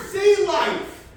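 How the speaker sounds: high, loud shout; shouted explanation from the beach; screamed warning. Acted. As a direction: yell it out across the water. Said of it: "they are screaming something".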